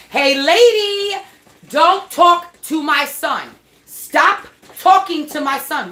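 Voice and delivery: high, loud, slow shout at the lady; retold yelling. Mother yelling at the landlady to leave son alone, loud and slow. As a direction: yell it slowly, near tears.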